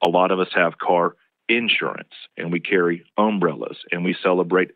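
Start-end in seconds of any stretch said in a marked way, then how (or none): 0.8-2.1 "insurance" as IN-surance, first syllable strongest; Southern initial stress. Shown as a demonstration. Linguist showing Southern habit, first syllable of insurance stressed. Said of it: "more stress on most of the initial syllables". 3.2-3.8 "umbrellas" as UM-brellas, first syllable strongest; Southern initial stress. Shown as a demonstration. Linguist showing Southern habit, first syllable of umbrellas stressed. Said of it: "more stress on most of the initial syllables".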